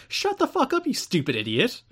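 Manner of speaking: high-pitched mock fury; hammed-up exasperated insult; retold angry outburst. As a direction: burst out high on the first words, then drop. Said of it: "and is like".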